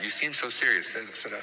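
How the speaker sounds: a hint of her heavy accent, repeated; mild Mother Teresa accent. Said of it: "She had a real heavy accent".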